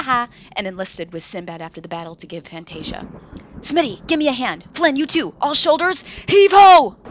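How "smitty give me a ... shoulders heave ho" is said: loud, high straining heave-ho call; rally the crew and heave; captain shouting orders to haul. Acted.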